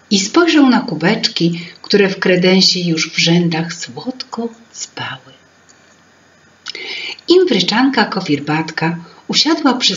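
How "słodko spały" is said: whisper. A narrator's reading. Cups sleeping sweetly.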